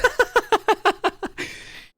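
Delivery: a sudden burst of high, loud laughter. Real, not acted. Laughing at the captain remark.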